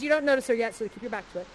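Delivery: usual speaking voice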